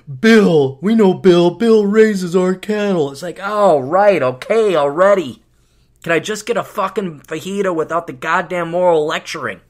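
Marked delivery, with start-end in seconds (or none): 0.1-3.1 loud, slow, preachy server boasting of their farmer; lording it over the customer, loud and slow; mock smug server. Comic voicing a smug organic server, loud and slow. Mocking organic restaurant staff. 3.4-9.6 loud, fed-up snapping back; fed up, cut the lecture short loudly; exasperated retort. Retold or imitated. Customer snapping back at the preachy server, loud and fed up.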